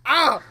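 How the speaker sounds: one high, very loud "ah"; big yelp as if bitten; loud yelp of pain. Retold or imitated. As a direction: reenact a startled cry of pain. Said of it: "like, a real loud".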